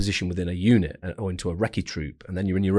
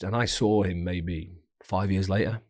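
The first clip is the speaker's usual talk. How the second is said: normal talking voice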